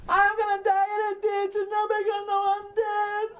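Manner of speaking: high wailing mock-frantic mother; sing-song hysterical mother voice. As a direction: whine it like a melodramatic mom. Mother's frantic phone call mimicked.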